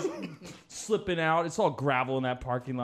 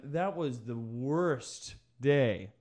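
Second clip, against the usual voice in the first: ordinary talk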